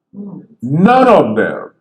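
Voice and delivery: None of them, slow, weight on None; emphatic. Real, not acted. Telling the host none were actors, slow and emphatic. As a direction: drag out None of them slowly.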